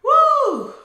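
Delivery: loud, high celebratory whoop; triumphant whoop. Real, not acted. Therapist celebrating that she crushed the test, triumphant whoop. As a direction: whoop at full voice.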